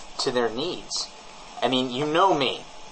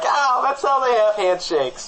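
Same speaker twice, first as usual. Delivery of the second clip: doting coo; 13 semitones higher, 4 dB louder than usual. Retold or imitated. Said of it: "and I'm like".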